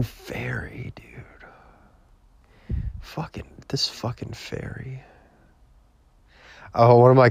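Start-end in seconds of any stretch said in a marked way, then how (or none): 0.0-5.0 gleeful unvoiced whisper, much softer. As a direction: relish the insult under your breath. Savouring the insult to himself.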